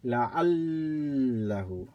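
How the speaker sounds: long held L in the middle of "لَعَلَّهُ"; doubled letter. Shown as a demonstration. Teacher showing reading by doubling, "لَعَلَّهُ" with prolonged L. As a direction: sustain the ل of "لَعَلَّهُ" on a level tone. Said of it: "by doubling, as we did right here".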